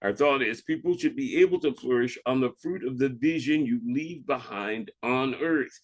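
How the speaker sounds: normal talking voice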